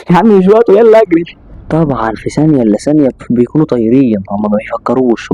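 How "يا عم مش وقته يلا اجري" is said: high, loud rush to run; laughing nervously then yelling to run; panicked urging. Acted. Hossam urging Abdo to flee the building: high panicked yell.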